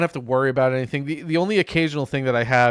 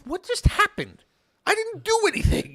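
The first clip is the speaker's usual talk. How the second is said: bewildered. Retold or imitated. Retold bewildered protest.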